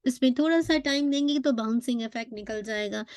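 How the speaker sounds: ordinary talk, nothing marked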